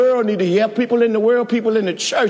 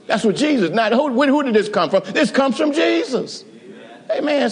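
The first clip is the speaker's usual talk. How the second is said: ordinary talk, nothing marked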